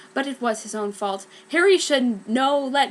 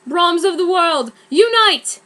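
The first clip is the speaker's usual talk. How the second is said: higher and louder, rising call; rallying cry; 7 semitones higher, 9 dB louder than usual. Acted. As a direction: proclaim the spell. Harry's rallying spell.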